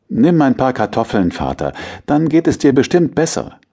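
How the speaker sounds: put-on high, eager young boy voice; a child sweetly persuading his dad; coaxing boy. A narrator's reading. Boy coaxes father.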